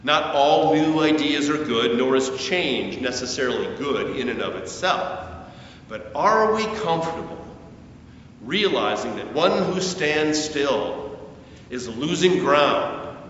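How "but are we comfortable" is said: ordinary delivery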